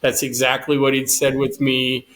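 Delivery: ordinary talk, nothing marked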